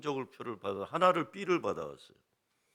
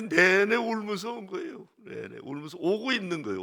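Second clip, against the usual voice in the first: tearful telling; 6 semitones higher, 6 dB louder than usual. Real, not acted. Tells father cried whole drive.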